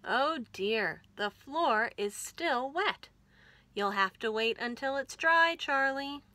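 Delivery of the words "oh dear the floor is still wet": ordinary delivery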